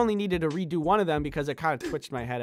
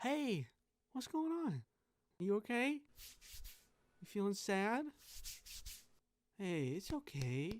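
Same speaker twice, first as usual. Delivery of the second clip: much softer, slow and tender; gentle comfort; 12 dB quieter, slower than usual. Acted. Softly comforting someone who feels sad. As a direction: hush and soothe a sad friend.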